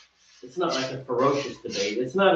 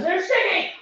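very high, mock-whiny squeal; playful squeal. Brewer leaving to wash his hands, playful high squeal. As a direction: squeal it in comic complaint.

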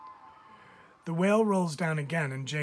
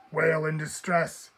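louder radio call, urgent; 7 dB louder than usual. Acted. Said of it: "he calls into his radio".

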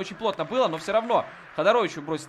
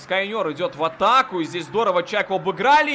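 normal talking voice

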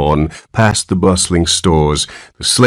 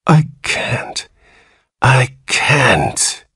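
moaning; 6 semitones higher, much slower than usual. Acted. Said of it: "he moaned".

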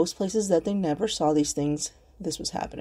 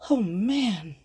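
ordinary talk, nothing marked

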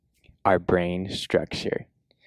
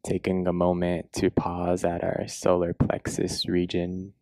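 guided meditation; 4 dB quieter than usual. Acted. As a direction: guide them gently into a body scan, unhurried.

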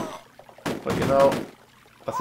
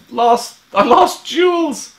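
high, drawn-out wail of loss; loud dismay; 14 semitones higher, 7 dB louder, much slower than usual. Real, not acted. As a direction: cry out over a fallen soldier. Dismay at losing a soldier.

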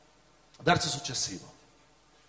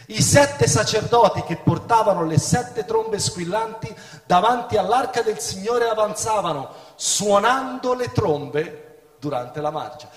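raised, solemn Bible reading voice; scripture reading; 6 semitones higher, 5 dB louder than usual. Acted. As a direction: read the verse out to the congregation. Preacher reads scripture aloud.

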